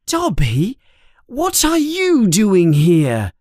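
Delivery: startled. A narrator's reading. Harry startled at night.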